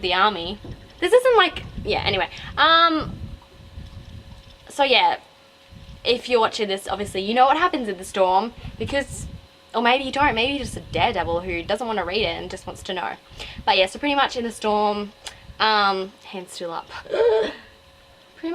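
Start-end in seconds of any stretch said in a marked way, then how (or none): 1.0-1.6 indignant. Real, not acted. 16.9-17.5 high gasp; sharp gasp. Real, not acted. Vlogger suddenly gasping mid-review, high and sharp.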